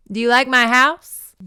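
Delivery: hammed-up haunted welcome; creepy ghost greeting. Ghost greeting the startled girl, loud spooky.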